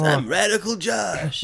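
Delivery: high, slow raspy voice. Shown as a demonstration. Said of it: "I had to do that raspy voice".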